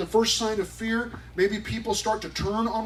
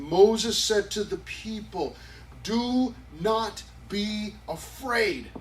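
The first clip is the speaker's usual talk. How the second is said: "Do not be afraid" drawn out slowly, each word weighted; slow, emphatic reading; 3 semitones higher, much slower than usual. Shown as a demonstration. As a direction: let "Do not be afraid" land slowly and firmly. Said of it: "he gives them a reason".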